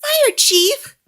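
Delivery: put-on very high, calling little girl. A narrator's reading. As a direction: call out like a small child. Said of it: "says a little girl".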